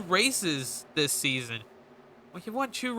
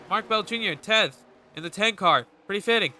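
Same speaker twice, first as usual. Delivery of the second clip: normal talking voice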